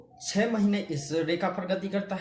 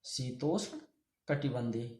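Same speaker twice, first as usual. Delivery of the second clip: softer repeat; 4 semitones lower, 5 dB quieter than usual. Shown as a demonstration. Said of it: "इसे बोल देंगे हम".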